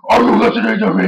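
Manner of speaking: a wrestler's overacted rant, retold; imitating angry. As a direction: ham up a hot-headed demand. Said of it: "he was all acting all angry".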